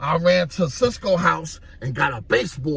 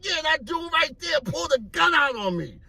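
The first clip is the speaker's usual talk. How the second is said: retold shout; 13 semitones higher than usual. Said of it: "I'm like".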